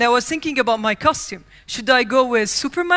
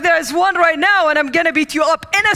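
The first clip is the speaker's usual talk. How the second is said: mock threat; 5 semitones higher, 5 dB louder, faster than usual. Kid threatening bullies.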